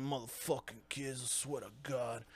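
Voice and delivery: low, very quiet muttering; muttered mock annoyance under the breath; acted janitor grumbling. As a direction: grumble to yourself while working. Acting out janitor grumbling while mopping.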